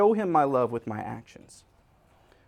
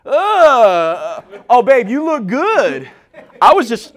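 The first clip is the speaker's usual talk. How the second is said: higher, louder and slow, eyes on the phone; hammed-up distracted husband; 7 semitones higher, 9 dB louder, slower than usual. Husband glued to his phone, brushing off his wife. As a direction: fake sweetness to his wife without looking up.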